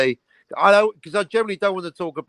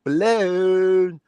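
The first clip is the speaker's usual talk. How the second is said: mind blown; 6 semitones higher than usual. Retold or imitated. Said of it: "And I was like".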